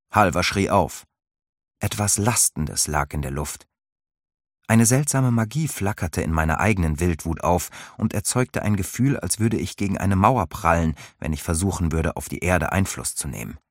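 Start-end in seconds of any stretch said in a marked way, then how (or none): none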